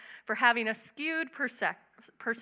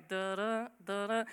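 soft hummed Jaws theme, softer; Jaws music; 2 semitones lower, 5 dB quieter than usual. Retold or imitated. Preacher hums Jaws music as Martha's storm nears, soft and ominous. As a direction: hum the shark's approach like a film score. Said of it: "where the Jaws music kinda starts".

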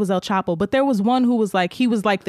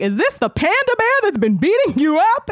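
much higher, hammed-up parent; mock outrage, pitched way up; imitated indignant mom; 11 semitones higher than usual. Mom meets the bully at conference, mock indignant.

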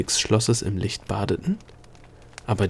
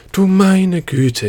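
higher, louder, slow awed cry; dismayed exclamation; 9 semitones higher, 6 dB louder, much slower than usual. A narrator's reading. Princess answering the gnome's rant, shaken and loud. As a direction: gasp it out, drawn and shaken.

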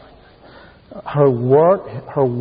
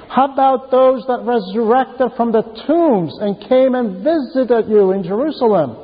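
the Lord reproaching; 8 semitones higher than usual. Acted. The Lord confronting the Pharisees.

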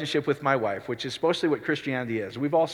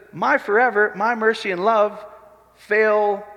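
higher and louder scripture quote; proclaiming; 6 semitones higher, 4 dB louder, slower than usual. Real, not acted. Preacher quoting scripture to the church, proclaiming. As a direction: ring it out.

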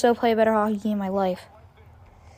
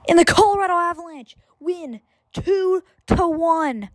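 game call; 7 semitones higher than usual. Real, not acted. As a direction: call the win like a broadcaster. Final horn, game call.

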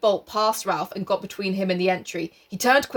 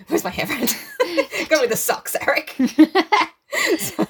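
usual speaking voice

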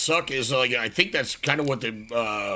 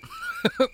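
high-pitched laugh. Real, not acted. Laugh after joke.

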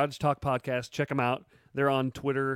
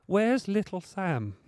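slow, higher put-on Sam Tarley voice; 6 semitones higher, slower than usual. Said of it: "That's my Sam Tarley impersonation".

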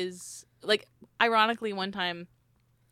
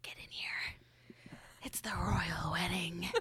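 whispering; 8 dB quieter than usual. Retold or imitated. Parents waking her for wedding.

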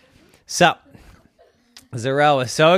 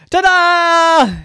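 high, loud drawn-out fanfare; triumphant ta-da; 11 dB louder than usual. A narrator's reading. Little gymnast announces herself to the family, triumphant. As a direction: belt it out like a little show-off. Child showing off after eating.

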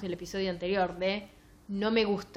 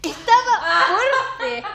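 high, loud, slow laughing repeat of the line; laughing mockery; 12 semitones higher, 11 dB louder, much slower than usual. Real, not acted. Host mocking a bad translation to her cohost, laughing loud and high. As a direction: cackle it out in disbelief. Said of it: "por qué no disfruté este libro de mierda".

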